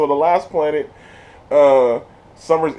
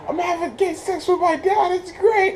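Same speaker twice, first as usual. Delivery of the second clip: high mock voice. Character voiced in retelling.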